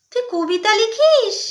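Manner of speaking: slow, loud astonished question; surprised. Acted. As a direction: stretch it out in disbelief. Discovers sister-in-law writes poems.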